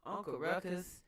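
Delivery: ordinary talk, nothing marked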